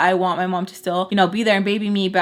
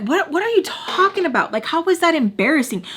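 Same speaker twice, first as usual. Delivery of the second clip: high-pitched, incredulous retort; retold yelling; 8 semitones higher than usual. Arguing back at her in a row, retold yelling. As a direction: fire back in disbelief, higher but not truly shouting. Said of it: "So I'm, like, yelling at her".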